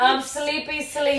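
mocking babytalk. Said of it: "mocks her phrase".

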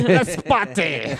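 hammed-up slow call to party; mock friend, hammed-up. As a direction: draw it out like a party host. Friend voiced calling party again.

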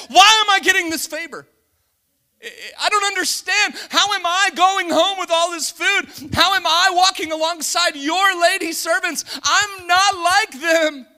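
loud, high, incredulous Ruth; Ruth bewildered, at full force. Retold or imitated. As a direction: cry out in disbelief at the favour. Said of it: "She said".

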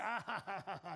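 acted ha-ha-ha laughing it off; mock hearty laugh. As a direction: laugh it off as a big joke. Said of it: "thought it was a big joke".

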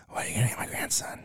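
hushed imitation of mom, breathy and quick; mock whisper. Son retelling mom's dinner question in a hushed voice. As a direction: whisper it like the nagging mom leaning in.

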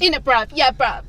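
mocking put-on London slang; rough london slang. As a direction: imitate a fake roadman accent. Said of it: "why are you putting on this act?".

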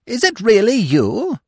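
high, loud, squeaky squirrel cry of surprise; shrill delight. A narrator's reading. Squirrel greeting Bambi again, shrill and delighted. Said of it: "he shrilled, surprised and delighted".

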